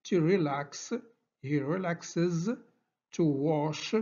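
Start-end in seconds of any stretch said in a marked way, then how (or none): 1.4-2.5 "relaxes" said very slowly, ending clearly as iz; iz ending demo. Shown as a demonstration. Teacher drilling the iz ending, very slow. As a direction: stretch "he relaxes" so the extra iz syllable is heard. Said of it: "كنطقو لو راديكال كروس، عاد كنزيدو ز".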